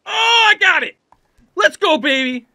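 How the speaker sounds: high, loud celebration; triumphant shout. Real, not acted. Player catches a hold, triumphant yelling. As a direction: cheer the big save. Saves a climb in game.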